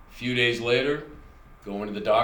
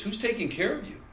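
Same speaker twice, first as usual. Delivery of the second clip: much higher, sing-song nurse voice; nurse's chirpy question; 10 semitones higher than usual. Retold or imitated. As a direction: play the oddly cheerful nurse asking. Nurse repeats her question.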